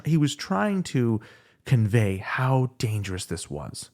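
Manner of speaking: usual speaking voice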